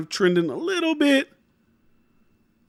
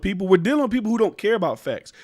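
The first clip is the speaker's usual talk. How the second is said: ordinary delivery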